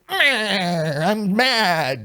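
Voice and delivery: lightly voiced grumpy leprechaun. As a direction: grumble like a sulky leprechaun. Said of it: "the leprechaun will just be like".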